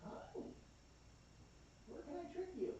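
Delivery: soft high coaxing. Real, not acted. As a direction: coax her up in a tiny voice. Coaxing cat again.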